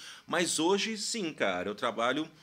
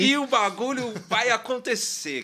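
excited; 11 semitones higher, 11 dB louder than usual. Retold or imitated. Voicing naive young musician's dream.

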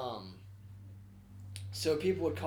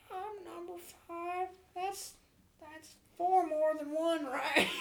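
dim caller voice; slower than usual. Retold or imitated. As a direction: play a clueless caller, high and slow. Prank caller voiced high, slow.

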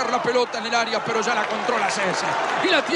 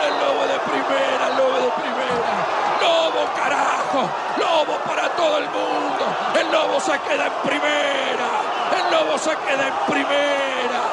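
breakneck goal commentary; 3 semitones higher than usual. Real, not acted. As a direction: yell the goal and chant it over and over. Team saved, endless goal celebration.

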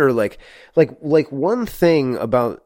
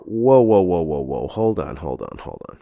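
imitating Ben; 5 semitones lower than usual. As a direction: echo Ben's hold on, dropping low. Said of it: "as Ben said before".

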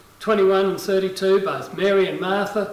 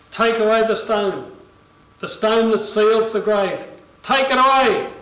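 raised, building to a loud order; preacher voicing a firm command, louder at the end; commanding call; 3 semitones higher, 5 dB louder than usual. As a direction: deliver it as a forceful public command. Acting out Jesus' command at tomb.

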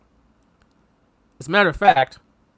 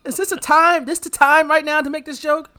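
higher, louder disbelief; incredulous outrage; 9 semitones higher, 5 dB louder than usual. Real, not acted. To co-host about Rip's joke, loud incredulous outrage. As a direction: demand whether that's a time for jokes.

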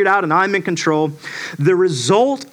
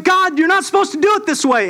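complaining to God; 10 semitones higher, faster than usual. Retold or imitated. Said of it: "you're going".

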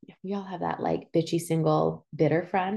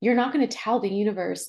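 ordinary talk

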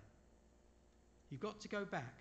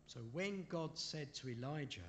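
usual speaking voice

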